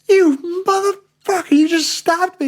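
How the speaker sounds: high, loud, slow indignant yell; retold shout. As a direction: yell at him, outraged he stabbed you. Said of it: "shouting at this guy".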